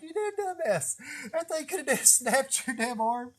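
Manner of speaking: high-pitched mock parent scolding. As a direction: scold yourself like an exasperated mom. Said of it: "like being a damn parent to myself".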